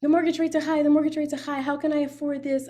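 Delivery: mock panic. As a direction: play a panicked homeowner, a bit overdone. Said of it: "It's like".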